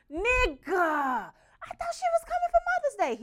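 high, loud, outraged squeal; outraged yell. Retold or imitated. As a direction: shriek it in disbelief at your brother. Outraged reply to brother.

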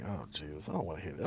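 low, soft grumble to himself; weary dismay. Retold or imitated. Voices his dread at cousin's posts.

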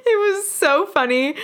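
high squealing laugh through the words. Real, not acted. [name] recounts Ari's rude reply, laughing hard. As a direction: laugh out the words, barely holding together.

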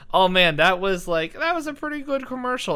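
high, bright praise; excited. Retold or imitated. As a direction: gush about the ad. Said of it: "it's like".

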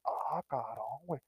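very slow, soft stunned awe; awed quiet exclamation. Retold or imitated. Watching his friend stomp the scorpion flat, slow stunned awe. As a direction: breathe it out in disbelief.

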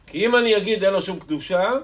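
loud, high, vehement exhortation; forceful preaching. Acted. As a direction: cry it out to the congregation.

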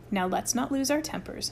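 usual speaking voice

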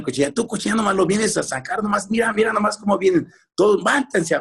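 high-pitched imitation of a nagging mum; mock-scold the grubby kids; scolding mother. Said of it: "siempre nos regañaba".

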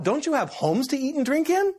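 high, incredulous rebuke; Paul's rebuke. Acted. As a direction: scold them in disbelief. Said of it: "And so Paul says".

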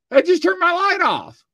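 high, loud disbelieving cry; startled yelp as the studio light dies; retold startled outburst. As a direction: blurt it out in shocked disbelief. Reacting to light going off.

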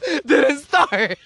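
high, drawn-out mock complaint; re-enacted blame, stretched out; defensive outburst. Acted quarrel, blaming.

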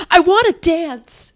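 high, slow, loud wail of the line; hammed-up dramatic plea; movie quote. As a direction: belt it out like the movie guy. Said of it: "He goes".

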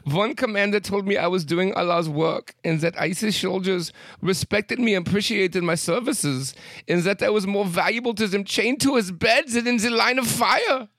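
high-pitched mock German accent. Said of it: "saying this in a German accent".

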